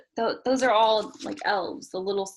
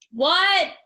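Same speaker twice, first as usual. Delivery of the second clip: loud high yell across the distance; 8 semitones higher, 7 dB louder than usual. Acted. Boy in doorway yelling to the conductor. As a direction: shout so the train can hear. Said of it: "I yelled".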